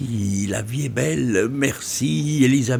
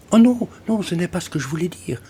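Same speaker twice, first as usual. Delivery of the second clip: protestation gênée; 11 semitones higher, 4 dB louder, faster than usual. Acted. Lynn se reprend, gênée.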